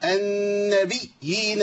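النبيين with a violent ياء; wrestling-match recitation. Retold or imitated. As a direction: imitate a strained reciter. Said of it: "يقول لك إيه".

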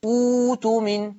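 أوت من begun on a long high "uu"; hamza as waw. Shown as a demonstration. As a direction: say أوت من as "uuu-tumin". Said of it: "واو".

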